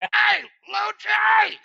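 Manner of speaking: high, long-drawn yell; screaming grandmother. Retold or imitated. Grandmother calls the kid from the porch, screaming. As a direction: holler the name across the street, stretched out and piercing. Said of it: "my grandmother would be screaming from the porch".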